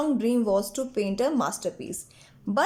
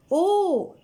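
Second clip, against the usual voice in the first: high, louder burst of "oh"; exclaiming; 8 semitones higher, 5 dB louder than usual. A narrator's reading. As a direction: cry out in sudden excitement. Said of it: "Sui exclaimed".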